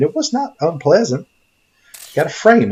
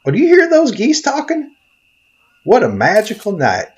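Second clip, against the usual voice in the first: excited; 8 semitones higher than usual. Acted. Thrilled by talking geese.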